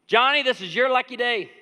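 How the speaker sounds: loud, high, big good-news call; excited announcement. Acted. Buyer hailing pumpkin seller Johnny with good news, excited. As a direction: hail him like he just hit the jackpot.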